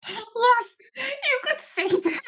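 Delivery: cracking voice on a shout. Real, not acted. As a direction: voice cracking up high. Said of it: "what happened to my voice?".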